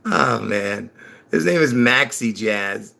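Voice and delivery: ordinary talk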